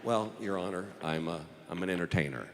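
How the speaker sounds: low, soft, halting answer; reluctant admission. Acted. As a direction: confess it quietly to the judge.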